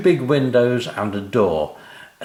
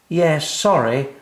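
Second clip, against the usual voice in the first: put-on sheepish child; 7 semitones higher, 4 dB louder than usual. A narrator's reading. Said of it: "said Sam".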